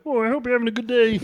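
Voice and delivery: put-on high, fast Irish accent. Guy wishing strangers a good day in an Irish accent. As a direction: breeze past in a cheery Irish accent. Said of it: "the Irish accent".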